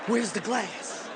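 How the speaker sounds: slow, drawn-out worried question; comic fear, slow and deliberate; acted dread. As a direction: stretch the words in stunned alarm. Frightened tourist facing loose gorillas.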